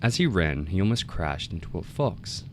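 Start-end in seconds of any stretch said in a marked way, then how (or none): none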